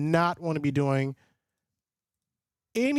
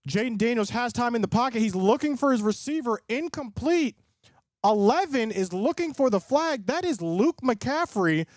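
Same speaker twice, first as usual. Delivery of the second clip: raised, brisk play-by-play call; higher-pitched sports broadcaster voice; live play-by-play; 5 semitones higher than usual. Acted. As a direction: call the drive like a TV announcer.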